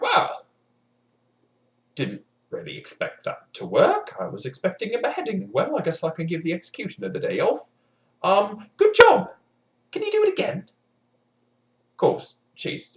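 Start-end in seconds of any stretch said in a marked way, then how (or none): 0.0-10.7 lightly voiced king.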